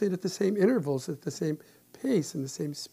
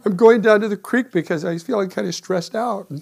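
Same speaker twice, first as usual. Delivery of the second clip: loud retort; 5 semitones higher, 10 dB louder than usual. Retold or imitated. Still quoting retort to wife.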